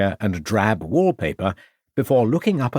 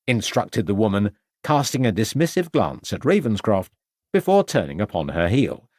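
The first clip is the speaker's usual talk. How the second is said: ordinary delivery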